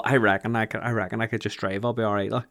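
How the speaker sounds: soft, scheming inner voice; sneaky whisper-like thought. Retold or imitated. Friend voicing the urge to flee, soft and sly. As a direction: tempt yourself quietly.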